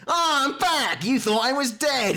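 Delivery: high, gloating creature voice; taunting orc. Acted. Undying orc taunting the player on return, high and gloating. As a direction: crow it high, as a returning villain.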